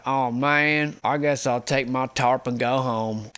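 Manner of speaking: put-on southern drawl; southern accent. Dejected guy giving up and heading home, southern accent. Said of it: "why he would have a southern accent".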